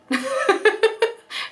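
high burst of giggling; high giggle. Real, not acted. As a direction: giggle at the punchline. Laughs at punchline.

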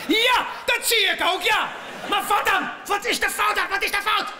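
high, loud frustrated shouting at the screen; exasperated shouting. Retold or imitated. Comedian yelling at his laptop's error message, exasperated. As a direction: yell at the broken website in disbelief.

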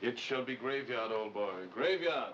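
low, slow vow of secrecy; solemn promise. Acted. Man pledging secrecy about a favor to his friend, solemn and low. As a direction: intone it low and slowly, like a solemn oath.

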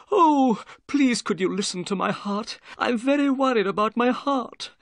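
high, excited, worried little man; Thaddeus Sholto. A narrator's reading. Nervous man begs doctor to check his heart, excited. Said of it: "cried Thaddeus Sholto excitedly".

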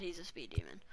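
hushed, unvoiced whisper; scared whisper. Real, not acted. Gamer to viewers about a fast player, scared whisper. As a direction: whisper it, as if intimidated. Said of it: "I'm scared".

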